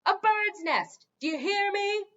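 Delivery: loud, slow parting shout; shouted insult. A narrator's reading. Gargoyle shouting after the departing king. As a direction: shout it after him as the door closes.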